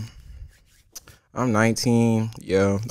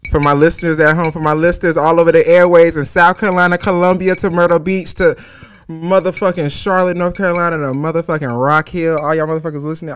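loud, higher radio DJ shout-out; radio host voice; 6 semitones higher, 8 dB louder than usual. Pretend. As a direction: hype the listeners like a drive-time DJ.

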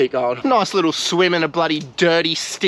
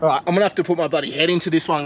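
normal talking voice

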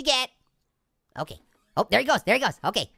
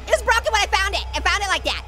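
higher, rushed pleading excuses; mock excuses; 6 semitones higher, faster than usual. Player pleading innocence to the neighbor, higher and rushed. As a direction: talk your way out fast.

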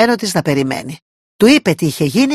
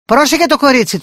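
called out; 7 semitones higher, 3 dB louder than usual. Acted. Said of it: "του φώναξε".

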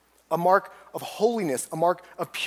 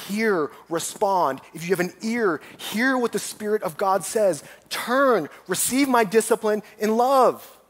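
pleading; 4 semitones higher than usual. Acted. Preacher voicing Jesus to the churches, pleading with growing force. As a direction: implore them, rising to a forceful close. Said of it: "he's pleading with the churches".

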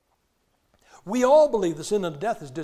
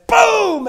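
high loud mock gunshot; shouted gunshot noise; shouted boom; 13 dB louder than usual. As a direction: blurt out a gunshot sound. Mock gunshot in sermon story.

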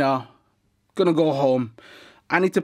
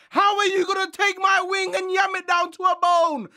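outraged shouting. Acted. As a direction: blast it out in disbelief, way up high. Outraged at man taking wing.

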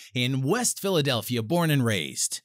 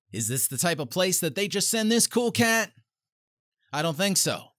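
usual speaking voice

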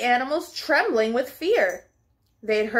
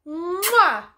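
kiss sound; 4 semitones higher than usual. Acted. Dog kissing little dog, smacking kiss. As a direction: plant a big kiss.

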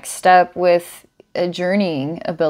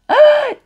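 amazed gasp; 4 dB louder than usual. Real, not acted. Amazed by the patron's vision.

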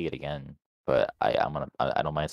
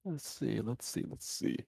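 soft murmur while thinking, much softer; muttering to self; 5 semitones higher, 13 dB quieter, slower than usual. Real, not acted. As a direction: whisper it to yourself while thinking. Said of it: "thinking aloud".

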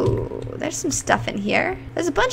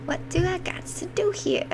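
quiet unvoiced whisper to herself; 4 semitones higher, 8 dB quieter than usual. Real, not acted. Frustrated player whispering to herself, hushed. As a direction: breathe it under your breath.

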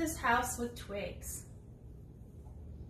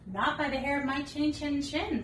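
higher squeaky little pig; a higher piggy voice; 5 semitones higher, 5 dB louder than usual. Acted. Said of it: "said the pig".

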